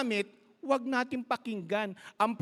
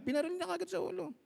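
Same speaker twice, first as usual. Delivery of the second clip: higher, softer and fast; quick exclaimed retelling; 9 semitones higher, 7 dB quieter, much faster than usual. Retold or imitated. Preacher re-telling the shooting to the congregation, fast and high. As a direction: rush it out, high and hushed.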